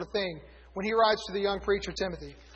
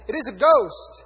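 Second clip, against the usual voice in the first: loud frightened shout, pitch up; terrified cry; 3 semitones higher, 9 dB louder than usual. A narrator's reading. Disciples shouting in terror at Jesus on the sea. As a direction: cry out like you've seen a ghost. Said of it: "they were terrified and said".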